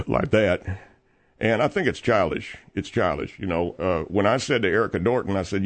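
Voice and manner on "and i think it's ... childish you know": ordinary talk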